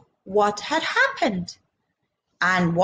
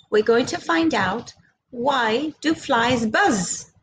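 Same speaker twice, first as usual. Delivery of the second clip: ordinary delivery, nothing marked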